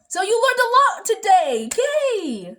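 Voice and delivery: high, loud celebratory burst; mock-perky cheer. Vlogger congratulating viewers on learning, hammed-up glee. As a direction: cheer it like a perky children's host.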